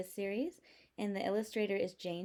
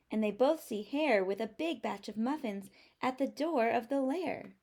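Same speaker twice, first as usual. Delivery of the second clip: ordinary delivery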